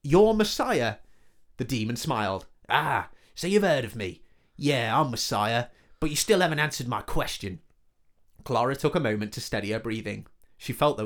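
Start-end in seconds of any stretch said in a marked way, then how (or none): 0.0-0.9 slow, loud disbelief rising high; dawning shock as it clicks; surprised. Acted. Realising the demon is Messiah. 2.7-7.6 lightly voiced smug demon. Demon speaking, pleased.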